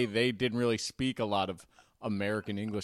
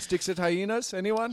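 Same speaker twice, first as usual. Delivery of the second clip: high, hopeful mock South African accent; South African kid; 9 semitones higher, 3 dB louder than usual. South African kid inviting the cafeteria to play, high mock accent. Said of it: "both his parents were South African".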